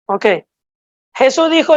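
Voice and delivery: usual speaking voice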